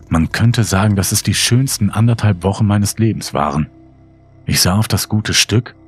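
plain reading voice

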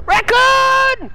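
high, loud delighted yelp; excited cry. Real, not acted. Player spotting a raccoon, high and loud excited cry. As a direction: burst out with joy at the find.

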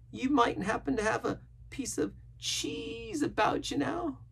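slow, very high sailor voice; wistful marooned castaway. A narrator's reading. Castaway confiding his dreams of cheese, longing. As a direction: ramble longingly in a high, drawn-out voice.

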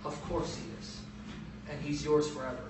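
soft, hushed, reassuring Father; gentle Father. Acted. God the Father answering Jesus, soft and reassuring. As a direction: answer softly, like a loving father. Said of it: "And the Father says".

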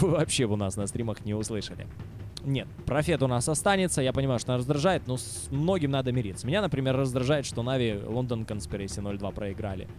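ordinary talk

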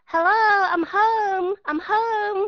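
high, showy mock cry of I'm home; imitating a stage actor. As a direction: ham up an actor's homecoming cry. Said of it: "diễn kịch đó hay nói".

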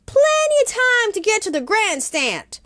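put-on girl's voice, Katie. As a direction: play Katie, young and eager. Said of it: "Katie said".

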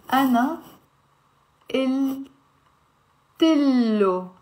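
"ana il tillo" in separate chunks with pauses, long l in "tillo"; "ana... il... tillo", t carried over into "tillo"; linked, slow syllable breakdown. Shown as a demonstration. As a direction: draw out the double L in "tillo". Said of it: "So we will end up saying".

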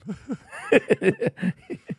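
loud high-pitched laugh; laughter. Real, not acted. Co-host mocks the fake listener name, real laughter.

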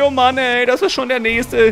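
usual speaking voice

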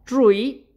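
"tree" said with a plain t, like "trui"; "tree" with a hard t sound; pronunciation demo. Shown as a demonstration. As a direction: say "tree" with a crisp t, no th. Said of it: "aquí dice".

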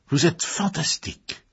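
high-pitched admiring exclamation; amazed cry. A narrator's reading. Haydn admires Diana in her costume, amazed cry. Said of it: "s'écria-t-il".

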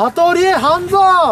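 slow, stretched calling out a name; drawn-out summons. Acted. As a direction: call his name out slowly. Summoning the helper again.

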